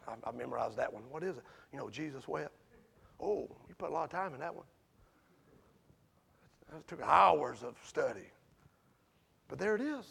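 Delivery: soft, low sermon voice; hushed sermon storytelling; hushed preaching. Acted. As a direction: draw them in quietly. Hushed storytelling of Jesus wept.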